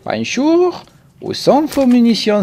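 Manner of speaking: put-on high German accent, hard B as P. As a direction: bark the greeting like a German soldier. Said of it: "Je sais pas pourquoi un accent allemand".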